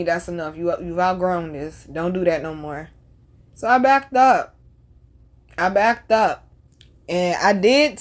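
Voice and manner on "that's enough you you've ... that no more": low and soft; quiet, solemn warning; hushed voice of God. Retold or imitated. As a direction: speak it softly, like a quiet inner voice. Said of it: "God telling me".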